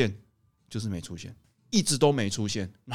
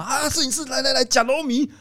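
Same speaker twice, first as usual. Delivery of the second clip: 啊，摄影师 called out higher, mock hostess urging; eager bride's mother; 12 semitones higher than usual. Photographer retelling the bride's mother pressing noodles on him, higher mock urging. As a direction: imitate a pushy auntie offering food, voice raised.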